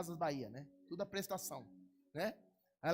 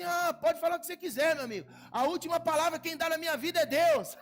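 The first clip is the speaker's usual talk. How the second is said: high, loud, laughing defiance; defiant declaration; 9 semitones higher, 11 dB louder than usual. Pretend. Pastor defying bad news, loud laughing declaration. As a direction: shout your faith at the accuser. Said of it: "Eu falo assim".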